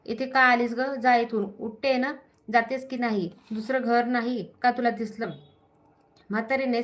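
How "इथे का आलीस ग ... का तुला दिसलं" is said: ordinary delivery, nothing marked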